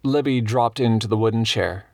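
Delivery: ordinary delivery, nothing marked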